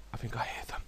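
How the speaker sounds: quick, breathless whisper. Real, not acted. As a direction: hush it so they won't hear. Sneaking near enemies.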